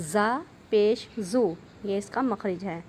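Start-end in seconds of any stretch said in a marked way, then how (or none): 0.1-1.5 slow زو with a hard, firm buzz. Shown as a demonstration. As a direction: press زو out firmly. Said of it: "زا کو ہم نے سختی سے پڑھنا ہے". (زو سختی سے)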